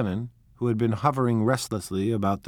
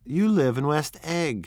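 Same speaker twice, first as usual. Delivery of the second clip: contemptuous; 6 semitones higher than usual. Acted. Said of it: "she remarked contemptuously".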